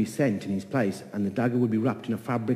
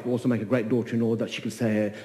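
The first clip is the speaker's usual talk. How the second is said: ordinary delivery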